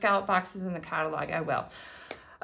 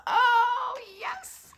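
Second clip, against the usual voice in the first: delighted squeal; 14 semitones higher than usual. Real, not acted. Imagining a thank-you note.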